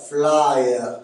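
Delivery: "flyer" for fleur, vowel split into ah-eur; Québécois diphthong. Shown as a demonstration. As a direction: glide "flyer" like fla-eur. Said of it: "Le son E se prononce A E".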